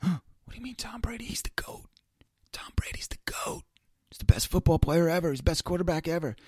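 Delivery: gasp, then a hushed whisper; shocked whisper. Retold or imitated. Mock fan defending Tom Brady, gasped shocked whisper. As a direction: whisper it as if it's sacrilege.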